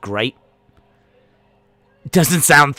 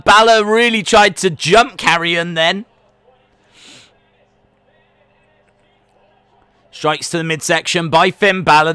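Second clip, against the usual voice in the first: raised, higher live wrestling call; play-by-play commentary; 8 semitones higher, 6 dB louder than usual. Acted. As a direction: call the action like a ringside commentator. Calls the wrestling entrance and action.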